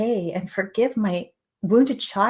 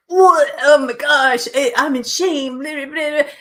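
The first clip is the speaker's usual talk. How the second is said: higher, louder hammed-up freakout; imitating a hysterical, louder panic; mock panic; 6 semitones higher, 8 dB louder than usual. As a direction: act out a panicked outburst of shame. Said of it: "hysterically crying, running around".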